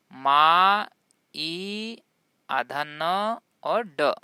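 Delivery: "मा, ई, आधा न और ड" piece by piece, with pauses; sounded out in parts. Shown as a demonstration. Teacher breaking mind down for learners, sound by sound. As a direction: draw out each sound of "माइंड" separately. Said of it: "माइंड".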